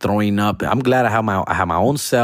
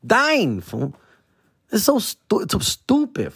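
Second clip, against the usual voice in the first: high whine; 10 semitones higher than usual. Acted. High exasperated.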